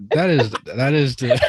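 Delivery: loud, high and laughing; laughing shout. Real, not acted. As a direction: blurt it out through laughter. Host laughing at the joke.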